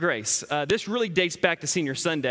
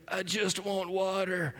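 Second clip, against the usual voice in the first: put-on softer, slow, dry and raspy little girl; 6 dB quieter, slower than usual. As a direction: rasp it out weakly like a parched, sick child. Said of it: "dry, raspy, like she's been wandering in the desert".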